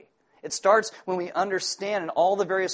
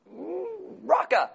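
mock road rage; slower than usual. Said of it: "and I'm like".

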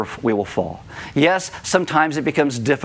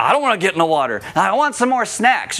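put-on fast, higher, whining kid; whiny child voice; 4 semitones higher than usual. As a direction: play the scared kid who wants snacks. Kids refusing to enter water.

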